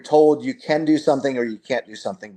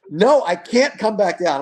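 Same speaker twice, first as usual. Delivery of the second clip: put-on high, slow, frightened child voice; scared whining kid; 7 semitones higher than usual. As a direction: whine it out like a frightened girl. Scared daughter refusing on high platform.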